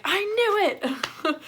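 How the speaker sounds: drawn-out, high, delighted shout; slow, gleeful I-told-you-so; excited Thor. Retold or imitated. Retelling Thor's delighted reaction.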